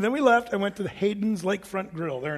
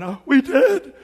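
freaking out; 5 semitones higher, 6 dB louder, slower than usual. Retold or imitated. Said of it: "just totally freaking out".

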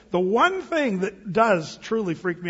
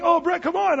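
higher, lightly mimicked coaxing; urging kids; 8 semitones higher than usual. Kids to pastor stuck in the tunnel, urging. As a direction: imitate kids egging someone on.

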